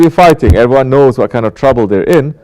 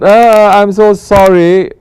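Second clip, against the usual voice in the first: high, drawn-out whiny "sorry"; mock apology; 8 semitones higher, 3 dB louder, slower than usual. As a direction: stretch out a hammed-up apology, pitched way up. Mocks an empty apology.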